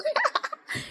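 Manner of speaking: short squeal of a laugh; high delighted giggle. Real, not acted. Vlogger laughs with joy at the reveal, high giggle.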